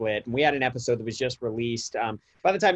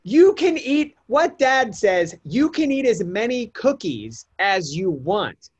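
put-on kids in disbelief; 8 semitones higher, 4 dB louder, slower than usual. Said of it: "And they're just like".

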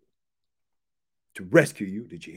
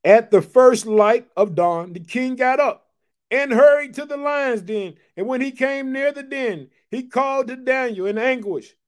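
scripture reading; 8 semitones higher, 8 dB louder than usual. Acted. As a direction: proclaim the dawn scene. Preacher reads scripture aloud.

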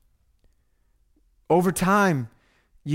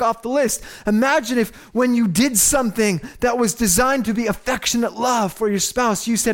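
raised, high-pitched sermon delivery; impassioned preaching; 6 semitones higher than usual. Real, not acted. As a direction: press each point urgently on the congregation. Preaches at full force.

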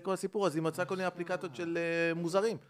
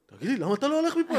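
higher, louder angry challenge; furious boyfriend turning on the stranger on the bed, loud; irritated demand; 10 semitones higher, 5 dB louder than usual. Retold or imitated. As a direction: bark at the stranger to get out. Angry man addressing the intruder.

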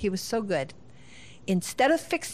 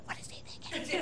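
whispered, incredulous mock outburst; hushed venting; 8 semitones higher, 10 dB quieter than usual. Parents behind the bedroom door, whispering in disbelief. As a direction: vent under the breath, half laughing.

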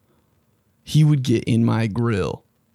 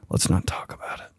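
whisper; 8 dB quieter than usual. Real, not acted. Aside after a stumble.

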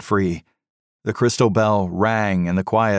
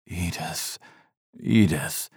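groaning; 4 dB quieter, slower than usual. A narrator's reading. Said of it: "he groaned".

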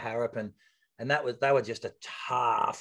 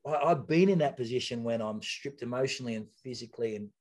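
ordinary talk

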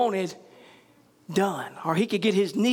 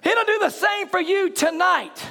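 fiery preaching; 8 semitones higher than usual. Acted. Preacher shouting faith at the church. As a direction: drive it home to the church. Preacher rising again to full force.

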